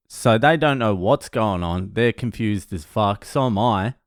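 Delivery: ordinary delivery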